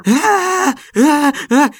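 shrill high startled screams; three panicked screams; scream of fright. Acted. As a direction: yelp as something grabs your foot. Sudden grab by a corpse.

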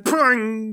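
high imitated spring twang; springing "pung". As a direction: make the noise of a spring let loose. Imitates a spring arm releasing.

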